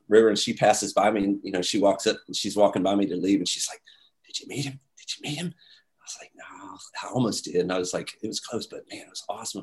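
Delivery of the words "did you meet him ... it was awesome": a quick secret whisper between friends; hushed whisper. Retold or imitated. In the lobby, whispering to Kelly about meeting Paul, hushed. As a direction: whisper it, much softer, unvoiced, not to be overheard.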